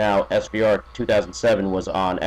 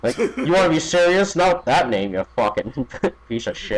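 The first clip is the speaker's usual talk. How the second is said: higher-pitched mock outrage with a laugh creeping in; mock fan rant; 6 semitones higher than usual. Disgusted fan mocking the Radical's name, playful higher-pitched rant. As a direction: heckle in pretend disgust, pitched up. Acting out a fan turning on him.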